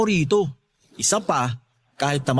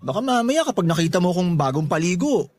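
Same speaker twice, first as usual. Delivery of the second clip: usual speaking voice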